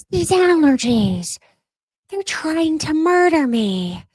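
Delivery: high, slow, theatrical whine; milk the suffering like a tragic heroine; mock dramatic. Complaining to viewers about allergies, hammed-up dramatic whine.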